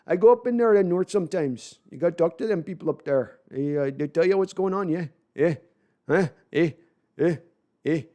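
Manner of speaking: lightly voiced Canadian accent, tag questions tossed up; a hint of a Canadian accent, playful; a loose Canadian accent with teasing eh tags. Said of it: "Nice Canadian inflection".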